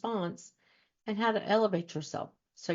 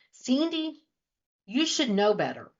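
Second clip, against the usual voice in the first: high, loud stern teacher scold; 6 semitones higher, 9 dB louder than usual. Retold or imitated. Host imitating a second grade teacher scolding her. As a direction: snap it like a strict teacher. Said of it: "Talk like that".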